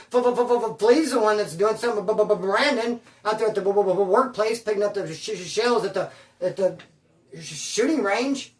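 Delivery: exaggerated repeated first consonants; imitated stuttering. Accused messenger blaming Flea to the yard, mock stutter. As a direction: stick on opening sounds, re-enacting a nervous stammer. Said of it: "I was stuttering just like that".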